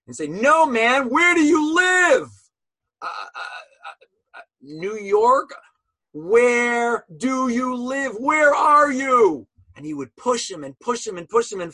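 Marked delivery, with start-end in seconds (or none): 0.3-2.3 loud, high, insistent demand; demanding shout. Retold or imitated. Rabbi shouting at the new prisoner, loud and demanding. 3.0-5.6 flustered stammer. Retold or imitated. 6.1-9.5 slow, loud, drawn-out demand; slow insistent shout. Retold or imitated. Rabbi pushing the prisoner again, slow and loud.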